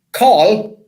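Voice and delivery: high, loud mock Yorkshire accent. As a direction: call it out in broad Yorkshire. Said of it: "in his clearest Yorkshire accent".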